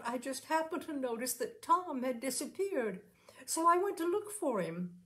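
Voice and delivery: a wronged wife spilling it all, voice climbing; upset young wife. Acted. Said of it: "Amy bit her lip".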